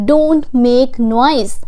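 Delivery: "don't make noise" said slowly; slow model. Shown as a demonstration. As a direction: teach it word by word. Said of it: "teacher models slowly".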